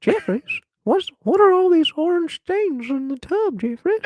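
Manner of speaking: put-on high, shrill old granny voice; nagging grandma calling out. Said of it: "say this in the grandma voice".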